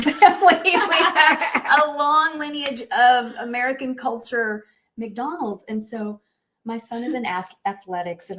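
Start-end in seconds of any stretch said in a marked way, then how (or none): 0.0-4.7 laughing. Real, not acted. Laughing at family eating habits.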